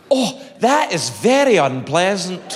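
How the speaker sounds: slow, drawn-out mock revulsion; appalled disgust. Doctor examining patient, slow appalled disgust. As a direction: recoil and stretch out every word. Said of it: "I swear she did this".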